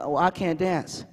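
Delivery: slow, low, soft admission; dejected little boy. Retold or imitated. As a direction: imitate a kid sheepishly owning up. Nephew admits he cannot dance.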